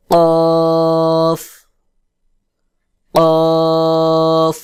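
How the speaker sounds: কফ from the back of the tongue, rounded "qoof"; hard rounded qof. Shown as a demonstration. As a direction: say কফ hard and round, vowel held long. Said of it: "শক্ত করে গোল মতো করে".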